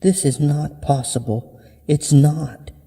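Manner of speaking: high-pitched, distressed denial; disbelieving girl. Acted. Girl refuses to believe the talking tooth, high distressed voice. Girl denies what is happening.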